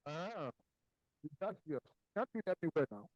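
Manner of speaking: muffled, like something blocking the sound; talking through a muffled line. Real, not acted. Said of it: "but you sound muffled, like something is obstructing the sound".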